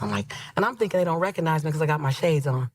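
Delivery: normal talking voice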